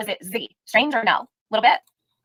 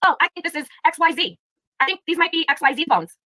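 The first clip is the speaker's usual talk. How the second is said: lightly voiced gossiping commenter, pitched up; mock commenters; 7 semitones higher than usual. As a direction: imitate idle speculation in a higher voice. Acting out speculating commenters.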